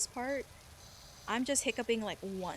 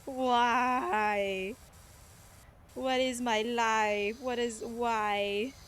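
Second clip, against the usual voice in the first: mock despair; 4 dB louder, much slower than usual. Groaning at a dad joke.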